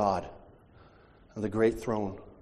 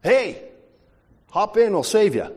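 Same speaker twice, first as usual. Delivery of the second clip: higher, louder hail; calling out from speedboat; 7 semitones higher, 5 dB louder than usual. Retold or imitated. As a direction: shout across the water. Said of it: "Another guy comes by".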